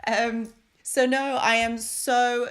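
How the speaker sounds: gushing thanks to the viewers. Real, not acted. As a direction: stretch it out with delight.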